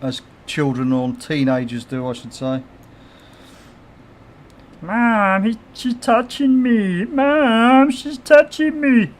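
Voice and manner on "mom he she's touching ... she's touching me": put-on high whiny kid tattling to mom; whiny tattling little sibling. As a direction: whine like a younger sibling telling on the other. Kids arguing, streamer imitates.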